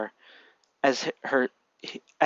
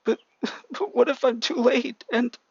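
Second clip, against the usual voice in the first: tearful doubt; 10 semitones higher than usual. A narrator's reading. Crying boy doubts.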